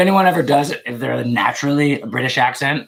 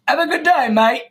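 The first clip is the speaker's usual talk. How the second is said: higher-pitched mock Australian accent; 10 semitones higher than usual. Streamer showing the group another accent, mock Australian. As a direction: put on a chirpy Australian greeting. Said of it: "That's Australian".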